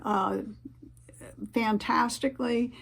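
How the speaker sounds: ordinary delivery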